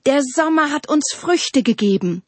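high-pitched eager call. A narrator's reading. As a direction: call out summer's gift as the trees. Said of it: "riefen die Bäume". (hell rufend)

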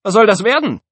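high, loud mocking challenge; sneering. A narrator's reading. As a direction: jeer at a rival. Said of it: "fragte Antoine höhnisch".

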